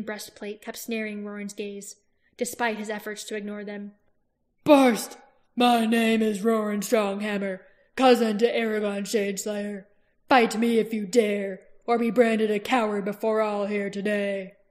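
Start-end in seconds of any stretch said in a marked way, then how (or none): 4.6-14.6 loud challenge. Acted. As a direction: proclaim it to the whole battlefield. Roran challenges Barst publicly.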